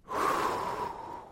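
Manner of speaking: long, breath-only sigh of dread; heavy sigh. Retold or imitated. Dad facing daughter's college Monday, heavy sigh.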